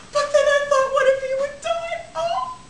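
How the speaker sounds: hammed-up sobbing lament; wailing cry. Bride explaining to the bridegroom, hammed-up wail.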